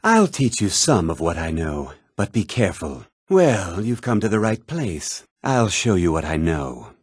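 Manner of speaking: ordinary talk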